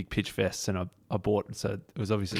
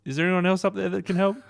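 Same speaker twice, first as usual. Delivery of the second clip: mock desperate call; 10 semitones higher than usual. A narrator's reading. Man asks for another helper.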